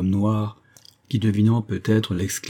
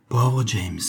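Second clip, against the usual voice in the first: understated sigh, falling at the end; sighed pity. A narrator's reading. Actress shaking her head at James, lightly sighing. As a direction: let the name fall in a mild sigh. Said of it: "soupira encore la jeune femme".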